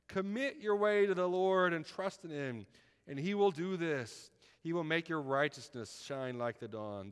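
preacher reading a psalm, loud then hushed; scripture reading. Acted.

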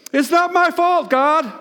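high whiny blame-shifting; mock excuse. Imagined modern sinner whining to God, mock excuse. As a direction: whine it like a child dodging blame. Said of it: "here's what we would say today".

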